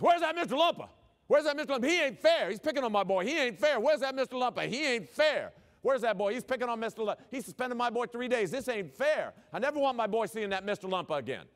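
yelling high and loud, mock-furious; big intimidating father raving in the school office, very loud; ranting parent. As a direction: storm in hollering for Mister Lumpa. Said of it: "He's out there yelling, ranting, and raving".

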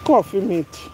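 normal talking voice

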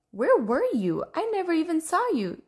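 puzzled, rising high at the end; a touch of baffled surprise; mystified. Acted. Rainbow Fish asking where Redfin hid, mystified.

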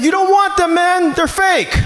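high, slow mock-panicked plea; high pleading. Robbed man pleads with the gunman, high and slow. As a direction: squeak it out like a scared victim. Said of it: "I was like".

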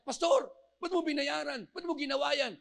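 can't believe what he hears; astonished question. Retold or imitated. Indebted man asking pastor in surprised disbelief.

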